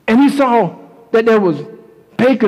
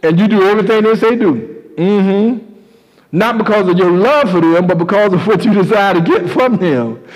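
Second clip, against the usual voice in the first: ordinary delivery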